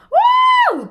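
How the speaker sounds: a very high, loud wolf howl; howling wouh. Shown as a demonstration. Guide howling with her listeners, wolf howl. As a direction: howl like a she-wolf at the moon. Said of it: "je vais le faire avec vous".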